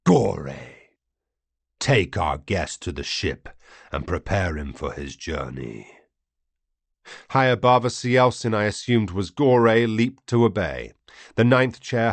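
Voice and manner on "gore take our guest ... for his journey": barked order. Acted. Vati ordering his escort to ship the prisoner, low. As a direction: order the escort coldly to take him. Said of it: "in its own tongue barked".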